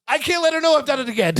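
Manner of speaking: high, fast, half-laughing panic; Larry. Retold or imitated. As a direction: squeak it out in a hurry. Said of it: "He goes".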